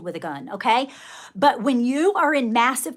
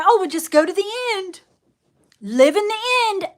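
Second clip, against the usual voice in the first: higher, sneering singsong parody; mocking gurus; 8 semitones higher than usual. Mimicking manifesting gurus to her viewers, scornful parody. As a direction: mimic the airy advice with scorn. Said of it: "And then you're sitting there".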